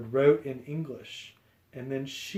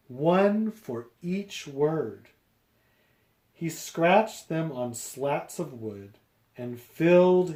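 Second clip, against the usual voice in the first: lively picture-book read-aloud; reading to children; 3 dB louder than usual. A narrator's reading. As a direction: read it out to a class.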